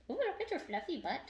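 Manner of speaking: high pet-talk coo. Real, not acted. Owner cooing at her fluffy dog, high pet-talk.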